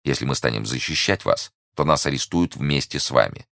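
usual speaking voice

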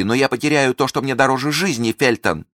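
high, loud anguished pleading; desperate plea. Acted. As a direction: beg him, nearly hysterical.

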